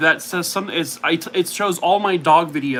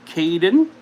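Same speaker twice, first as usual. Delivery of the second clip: ordinary talk, nothing marked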